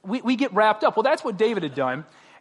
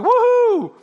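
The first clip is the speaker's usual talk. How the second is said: high, loud whoop of a football fan; mock fan cheer; 8 dB louder than usual. Preacher imitates a fan cheering an overtime game, whooping loudly.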